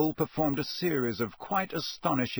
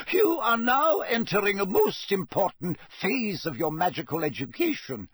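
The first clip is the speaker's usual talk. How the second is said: high-pitched, emphatic schoolmistress; stern McGonagall; 9 semitones higher than usual. A narrator's reading. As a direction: admonish the class in a high teacher's voice. McGonagall admonishes the class.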